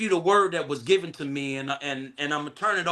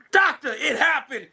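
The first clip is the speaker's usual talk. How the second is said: laughing as it bursts out higher; 5 semitones higher, 4 dB louder than usual. Real, not acted. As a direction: call out to the doctor through laughter. Preacher breaks up laughing mid-message.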